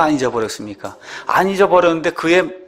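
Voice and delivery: normal talking voice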